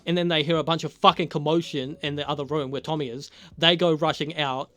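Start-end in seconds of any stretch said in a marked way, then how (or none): none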